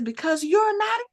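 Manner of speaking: half-hearted imitation of her yelling; mild mock yell. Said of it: "And she yells it".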